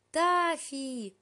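high, loud happy call; yelling. A narrator's reading. Ellie calls out to her cat from the car, yelling. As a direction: yell the name across the garden.

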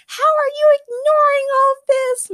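very high, loud, slow exasperated shout. A narrator's reading. Morgana shouting at the men ignoring danger. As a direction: shout it in disbelief. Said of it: "Morgana shouted".

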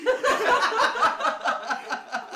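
high, loud burst of laughter; loud laughing. Real, not acted. Comic laughing at their own comeback, loud high cackle. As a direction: crack up hard.

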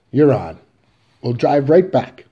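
hearty father. Acted. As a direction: Willie booming his promise. Willie agrees loudly.

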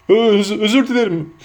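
high, stammering tearful giant; high-pitched, broken, hiccuping sob; sobbing apology. A narrator's reading. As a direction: choke out an apology through acted sobs. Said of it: "diye hıçkırdı".

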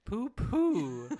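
high, loud and silly; hammed-up childish insult; gleeful mocking echo. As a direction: call it out high with a falling swoop. Gleefully echoing the review's insult.

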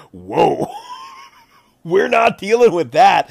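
loud, high, laughing alarm; laughing shout. Retold or imitated. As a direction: laugh it out loud and back away. Party balks at the monster.

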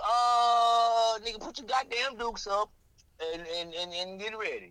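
high, loud tough guy; mock calling for a fistfight; hammed-up challenger.

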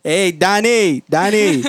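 high, loud, drawn-out holler; construction worker. Retold or imitated. Construction worker calling across the site at night, loud mock holler. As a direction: yell a buddy's name slowly. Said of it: "hear the construction workers talk".

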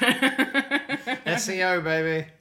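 slow loud spelled-out hype; drawn-out hype announcement; hyped up. Acted. Hyped joke.

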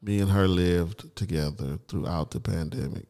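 ordinary talk, nothing marked

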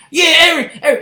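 loud, high mock cheer. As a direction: cheer his name like a fan in the crowd.

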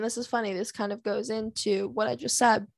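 usual speaking voice

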